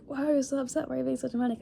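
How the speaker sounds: high-pitched mock friend voice; friend's questioning. Friend on the phone questioning her upset, high imitated questioning. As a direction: mimic a baffled friend, pitched well up. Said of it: "he's just like".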